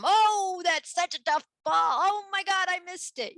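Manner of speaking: high exaggerated fake dismay; mock dismay. Older kids cheering up a little one, hammed-up dismay. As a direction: ham it up like the ball was impossible. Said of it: "would go".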